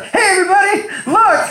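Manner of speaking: hammed-up shout pointing out a latecomer; mock announcing shout. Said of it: "be like".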